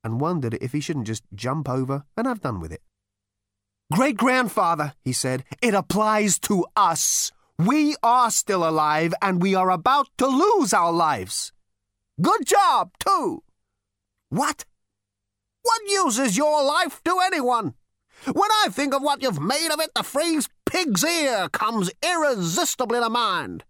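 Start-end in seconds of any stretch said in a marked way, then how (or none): 3.9-4.9 lightly raised brash voice; a hint of a brash galactic president; Zaphod's voice. 5.6-13.4 lightly raised brash voice; a hint of a brash galactic president; Zaphod's voice. 14.3-23.6 put-on high, scornful old man; ghostly great-grandfather scolding; crotchety old ghost. Ghost ancestor voiced.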